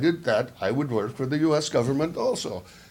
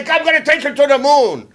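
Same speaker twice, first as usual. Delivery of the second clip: higher, louder Gleason boast; Jackie Gleason impression; 11 semitones higher, 8 dB louder than usual. Imitating Jackie Gleason's catchphrase, higher and louder. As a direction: bellow it like a TV comic. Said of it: "he used to say".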